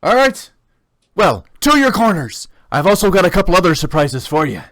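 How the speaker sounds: loud, high ringmaster call; announcer voice. Acted. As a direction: send them to their corners. Announcer sends fighters to corners.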